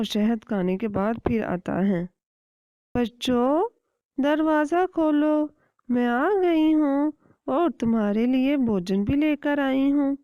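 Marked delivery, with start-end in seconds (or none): 2.9-10.2 higher, louder sugary put-on voice; villain faking a mother's sweetness; fake sweet mother. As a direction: lure them with honeyed tones. Kite fakes sweet voice.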